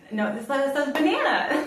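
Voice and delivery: half-laughing, play the frustration for fun; mock frustrated gibberish. To her viewers, imitating her frustrated gibberish, laughing. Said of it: "I'm like".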